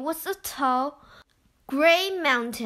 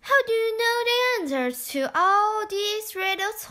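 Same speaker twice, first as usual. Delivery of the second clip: put-on higher, curious child; a wondering child, pitched up; Tai's voice; 7 semitones higher, faster than usual. A narrator's reading. Tai asks Hong about the riddles, higher child voice. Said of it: "said Tai".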